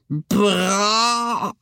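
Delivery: very high, outraged scream; old woman's howl. A narrator's reading. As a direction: scream the word back in disbelief. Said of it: "hylte hun".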